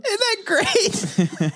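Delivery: high, slow laughing question; laughing through it. Real, not acted. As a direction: laugh as you say it. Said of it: "laughing reaction".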